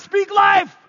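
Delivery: loud, high rallying shout. Acted. Preacher shouting a call for the crowd to echo, loud. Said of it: "three times".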